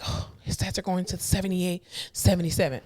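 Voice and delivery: hushed fretting over numbers; worried family member. Acted. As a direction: count the drop under your breath. Acting out worried family reading numbers.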